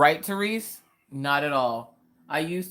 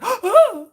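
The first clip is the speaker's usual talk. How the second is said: loud, high imitated shriek; mock yelp of fright after a gasp; startled scream; 12 dB louder than usual.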